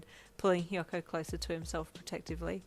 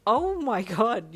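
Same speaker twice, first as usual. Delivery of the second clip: laughing; 5 semitones higher, 11 dB louder, much slower than usual. A narrator's reading. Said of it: "you said with a laugh".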